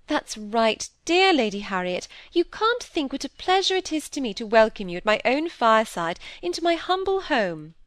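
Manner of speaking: fawning society hostess; gushing hostess. Acted. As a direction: gush politely over an honoured guest. Missus Gibson welcomes Lady Harriet.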